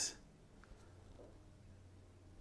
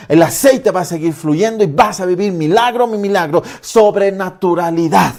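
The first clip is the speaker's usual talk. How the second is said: fervent preaching; 2 semitones higher, 4 dB louder than usual. Acted. Pastor promising miracles to those who keep praying, fervent declaration. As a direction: preach it as a bold confession.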